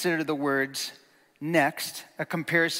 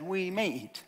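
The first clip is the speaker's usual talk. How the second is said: softer, half-hearted "We may eat"; hesitant, weaker quote; 3 semitones higher, 6 dB quieter than usual. Retold or imitated. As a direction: say "We may eat" timidly, without emphasis. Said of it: "Eve said".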